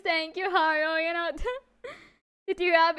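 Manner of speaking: ordinary talk, nothing marked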